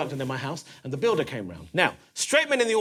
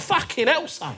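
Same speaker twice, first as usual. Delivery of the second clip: cockney geezer; 9 semitones higher, slower than usual. Retold or imitated. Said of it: "talking to him like I was Danny Dyer".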